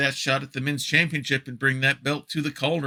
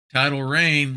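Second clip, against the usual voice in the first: normal talking voice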